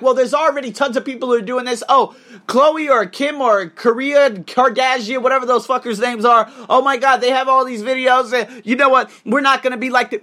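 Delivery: high, loud mock complaint; exaggerated high-pitched objection; mimicked whining naysayer. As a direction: imitate worried beginners making excuses. Mimics listeners' worried excuses.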